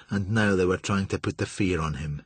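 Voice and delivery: plain reading voice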